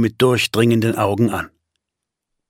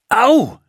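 sharp, very high cry of pain; yelp of pain; 4 dB louder than usual. Acted. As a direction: yelp as your hair is pulled. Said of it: "schrie Jenna".

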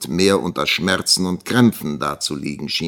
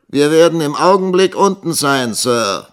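higher, louder reply, falling at the end; answering back; 10 semitones higher, 8 dB louder than usual. A narrator's reading. Young teacher answering the schoolmaster downstairs, calling back. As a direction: call the answer down to a superior. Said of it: "antwortete Nikolas".

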